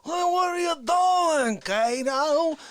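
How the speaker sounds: high, slow hammed-up yell at a wall; mock shout. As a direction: call out to the wall like a madman. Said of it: "He's like".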